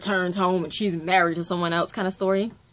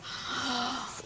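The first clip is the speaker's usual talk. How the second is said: big swooning intake of breath; gasp, overcome by the story; sharp gasp; 5 semitones higher, 6 dB quieter than usual. Real, not acted. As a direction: gasp of a thrilled reader. Reacting to the book's romance.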